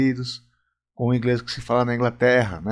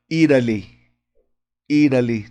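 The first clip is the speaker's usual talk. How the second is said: American "Italy" with the t as a quick tapped r; American accent; 4 dB louder than usual. Shown as a demonstration. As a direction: say "Italy" the American way, like IH-ruh-lee. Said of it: "o inglês americano, ele fala".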